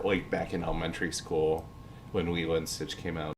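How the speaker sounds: ordinary delivery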